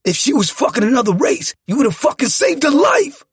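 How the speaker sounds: high, loud shouting; enraged accusing yell; furious bark. A narrator's reading. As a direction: bark it with spit flying. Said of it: "I barked with spit flying".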